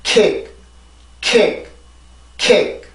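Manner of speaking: "킥" as English kick, final k barely released; soft final k. Shown as a demonstration. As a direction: say "킥" with English rhythm and a gentle closed ending. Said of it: "이렇게 발음을 해주시면".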